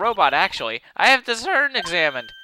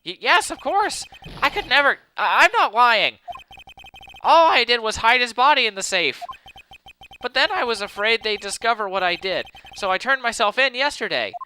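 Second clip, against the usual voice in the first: high, jittery nervous voice; nervous stammering man; 4 semitones higher than usual. Acted. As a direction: stammer it out like a scared suspect. Voices nervous Ron character.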